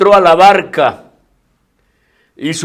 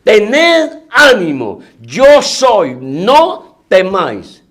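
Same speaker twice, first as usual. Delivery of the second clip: slower, drawn out, in a changed voice; Jesus' words again; 4 semitones higher, 4 dB louder, much slower than usual. Acted. Preacher repeats Jesus' words to the congregation, slow and solemn. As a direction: let each word hang, solemn and slow. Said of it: "Oiga la palabra de nuestro Señor".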